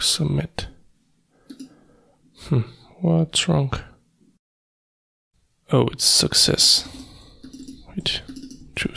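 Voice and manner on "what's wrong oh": ordinary talk, nothing marked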